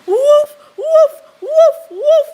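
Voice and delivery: high, loud woofs through a laugh; imitated dog barking. Laughing as she imitates her dog barking at her. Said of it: "he looked in my face and he said".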